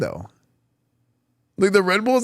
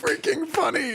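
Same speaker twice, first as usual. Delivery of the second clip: high-pitched real laughter running into the words; laughing hard; 13 semitones higher than usual. Real, not acted. Streamer losing it over the replayed Persian ad, laughing hard. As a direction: squeal it through helpless laughter.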